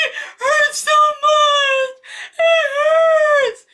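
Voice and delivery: high, slow wailing cry; bawling. Retold or imitated. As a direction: wail it out through tears.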